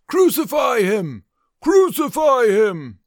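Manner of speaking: loud, high crowd shout, drawn out. Acted. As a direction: roar it as an angry mob. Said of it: "began shouting".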